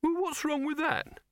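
high-pitched, defensive question; indignant demand. A narrator's reading. Silverfish defending his posters, high and indignant. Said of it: "Silverfish demanded".

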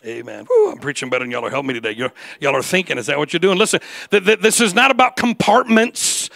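ringing pulpit voice; full-force preaching. Acted. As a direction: drive it home to the congregation.

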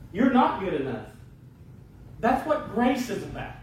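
high-pitched, emphatic pulpit proclamation; impassioned preaching. Acted. Preacher proclaiming grace to the congregation, impassioned and high-pitched.

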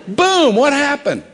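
high, loud, drawn-out exclamation; loud disbelief. Real, not acted. Preacher astonished he broke his resolve so soon, incredulous. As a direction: burst out with it, astonished at yourself.

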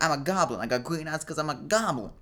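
put-on high-pitched boastful kid; proud child goblin. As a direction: crow it high and loud like a little goblin king. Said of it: "He was like".

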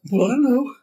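a high, loud, nervous squeak of false modesty; high squeaky coy. Retold or imitated. A hidden thrill squeaking out as the client asks what it costs. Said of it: "I was like".

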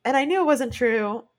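a mildly hammed-up distressed quote; upset retelling. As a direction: replay how upset you were. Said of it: "And I'm like".

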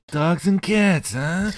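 high, loud, slow mock echo; mocking quote. Host teasing the villain's botched idiom, gleeful mockery.

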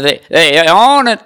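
a loud alarmed holler, retold; mock yell of alarm. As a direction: call out the warning to the tents. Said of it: "the guy hollered".

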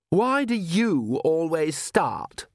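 high, drawn-out petulant challenge; demanding. A narrator's reading. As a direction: stretch it out as a spoilt daughter's demand. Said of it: "she demanded".

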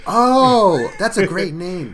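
ordinary talk, nothing marked

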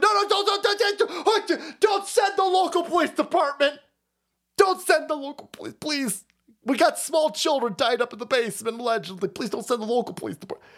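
high, frantic repeated pleading; mock panicked Pelosi. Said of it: "Paul Pelosi pleading".

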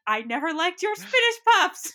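high, hammed-up villain outburst through laughter; Yzma. Quoting an Emperor's New Groove scene, hammed-up Yzma impression. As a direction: build to an indignant, high mock wail. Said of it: "where Yzma's like".

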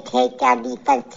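ordinary delivery

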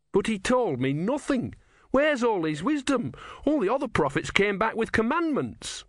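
put-on high, earnest young novice; young Brutha voice. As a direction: ask it wide-eyed and high. Brutha speaks.

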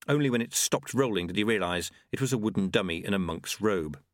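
usual speaking voice

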